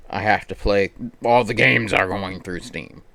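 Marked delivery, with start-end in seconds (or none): none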